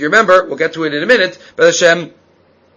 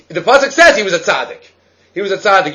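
usual speaking voice

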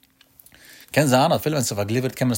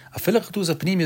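pitched much higher in Talmud chant; raised sing-song; 6 semitones higher than usual. Shown as a demonstration. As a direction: lift "A viele Bachtus" high, lean on "viele". Repeating the Talmud phrase higher.

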